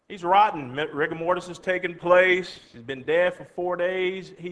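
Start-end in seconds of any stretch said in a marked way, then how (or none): none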